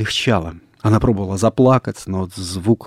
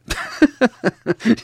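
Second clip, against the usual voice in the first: high, voiced burst of laughter; acted mocking laugh; scornful laugh. A narrator's reading. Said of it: "Николай засмеялся".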